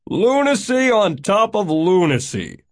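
loud, high exclamation; high, loud disgusted rant; exasperated outburst. A narrator's reading. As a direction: cry out in scornful disbelief. Mavis scorns the change of mind.

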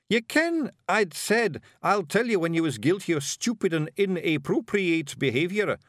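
high, brisk Scottish little warrior; Scottish pictsie leader. Acted. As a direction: bark it out like a tiny Scots chieftain. Rob Anybody's Feegle voice.

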